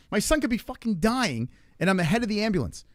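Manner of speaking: high-pitched, exasperated amazement; incredulous disbelief. Retold or imitated. Parent outrunning his son's ambulance, high incredulity. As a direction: marvel at how absurd it is, voice pushed up. Retelling absurd realisation after laughing.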